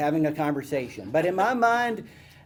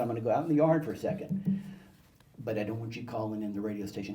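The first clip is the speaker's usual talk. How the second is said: lightly voiced dad, quick and lower; dad's warning; 6 semitones lower, 5 dB quieter, faster than usual. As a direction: lay down a rule in passing, low and quick.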